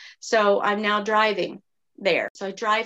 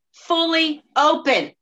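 exasperated; 6 semitones higher, 6 dB louder, slower than usual. Real, not acted. Exasperated reveal of open window.

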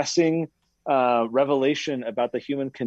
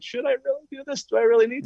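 high anxious questioning; nervous subject asking whether to keep going, pitched up; mock terrified; 11 semitones higher, faster than usual. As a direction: ask like you're scared to go on.

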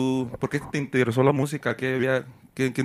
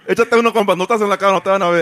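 high, louder teasing push; playful urging; 6 semitones higher, 5 dB louder, faster than usual. Real, not acted. Host teasing the guest to drink off camera, high and loud. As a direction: egg him on to take a drink.

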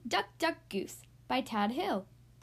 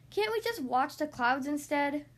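ordinary delivery, nothing marked

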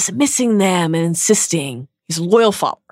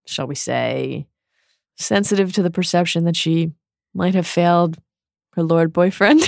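ordinary delivery

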